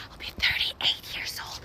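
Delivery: whispering her age as a playful secret. Real, not acted. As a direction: hush it like a confession.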